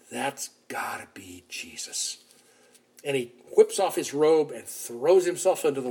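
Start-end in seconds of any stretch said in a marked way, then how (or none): 0.1-2.2 hushed whisper of recognition; awed whisper. Retold or imitated. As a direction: breathe it out in a stunned whisper. Peter realizes, hushed.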